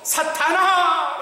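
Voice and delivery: God summoning Satan to rebuke; rebuking call. Retold or imitated. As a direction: call him out with authority. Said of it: "하나님이 꾸짖으시네요".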